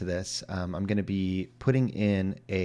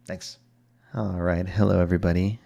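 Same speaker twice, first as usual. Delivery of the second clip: usual speaking voice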